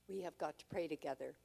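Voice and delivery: ordinary delivery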